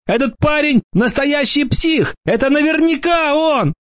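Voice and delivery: very high, squeaky accusing wail; whiny high-pitched character cry; hopeful wail. A narrator's reading. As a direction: squeal it, desperate to shift the blame. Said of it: "с надеждой в голосе завопил червь".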